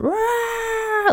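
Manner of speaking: high, drawn-out mock baby shriek; imitated baby scream. Mother mimicking Fred screaming in the background, mock baby scream. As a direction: shriek like a screaming baby, without extra volume. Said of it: "Fred in the background".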